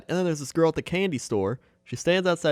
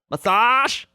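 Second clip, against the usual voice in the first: much higher, louder sing-song "Massage?"; massage lady; 11 semitones higher, 7 dB louder than usual. Retold or imitated. Retelling the mall massage lady, high loud call. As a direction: call out like a pushy mall vendor. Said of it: "she'd just be like".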